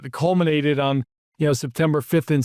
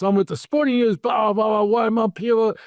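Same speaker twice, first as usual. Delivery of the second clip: furious reporter; 6 semitones higher than usual. Retold or imitated. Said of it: "he came to you furious".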